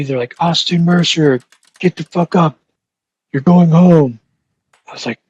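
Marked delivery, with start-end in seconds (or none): 0.4-4.2 loud, higher-pitched wake-up call; imitated guard's shout. Guards waking inmate at three for release, imitated shout. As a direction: call out like a guard rousing a sleeper.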